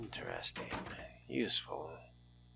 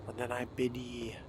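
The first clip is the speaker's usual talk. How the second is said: ordinary talk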